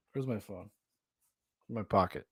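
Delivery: a quiet mock mutter of someone fumbling for a phone; muttered to self. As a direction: mumble it under your breath. Mimics the filmer murmuring.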